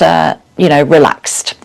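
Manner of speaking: usual speaking voice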